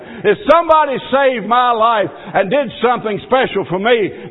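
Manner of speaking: loud, high pleading preacher's cry; impassioned revival sermon, rising at the end; fervent preaching. Acted. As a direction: plead with the congregation, building. Preaching at full force.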